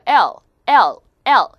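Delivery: loud "L", tongue touching behind upper teeth; L sound. Shown as a demonstration. Teacher drills viewers, loud clear "L" with tongue released. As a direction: tap tongue to teeth ridge on "L". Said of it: "윗니 뒤쪽에 혀끝이 붙었다".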